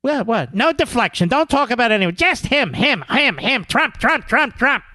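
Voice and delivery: high, insistent punched repetitions; mock obsessive fixation. Host mimicking media fixated on Trump, frantically insistent.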